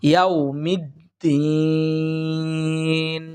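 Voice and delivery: "ইয়াউমিদ্দিন" very slow, "mid" then long-held "deeen"; correct tajweed. Shown as a demonstration. Teacher modelling the three-alif stretch, careful tajweed. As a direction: draw the end of "ইয়াউমিদ্দিন" right out. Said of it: "সহিভাবে পড়ার চেষ্টা করব".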